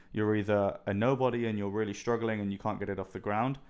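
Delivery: ordinary talk, nothing marked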